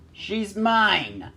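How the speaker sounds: high, possessive cry; yelling. A narrator's reading. Another suitor in the mob claiming the princess, mild yell. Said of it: "someone else yelled".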